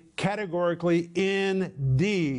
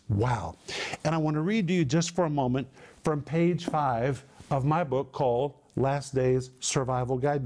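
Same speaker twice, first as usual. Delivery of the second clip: ordinary talk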